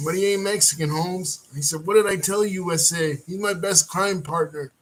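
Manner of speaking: Big Happy. Retold or imitated. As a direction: voice the big kid vouching for his friend. Big Happy speaking to Santana.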